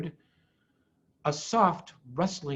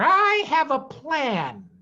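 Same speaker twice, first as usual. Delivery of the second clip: higher, slow and louder, self-satisfied; smug farmer Bean; 8 semitones higher, 5 dB louder, slower than usual. Acted. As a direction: savour the announcement. Bean boasts in read story.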